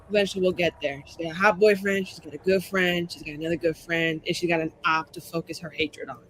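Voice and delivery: normal talking voice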